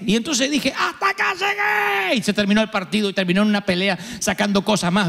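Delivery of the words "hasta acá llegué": slow, much higher yell; he finally blows up; mock outburst. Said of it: "exploté". (estallido fingido, lento y muy agudo)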